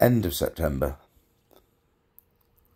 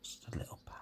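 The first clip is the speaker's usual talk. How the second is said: whisper; 20 dB quieter, much faster than usual. Real, not acted. Whispered aside.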